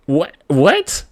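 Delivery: high, loud, disbelieving outburst; incredulous, pitch leaping upward; startled mom. Retold or imitated. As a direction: imitate a mother's shocked double-take. Mom's startled look, acted out.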